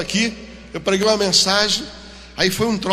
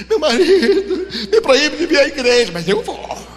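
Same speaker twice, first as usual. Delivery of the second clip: mock crying; 6 semitones higher, 4 dB louder, slower than usual. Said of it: "E ela chorando".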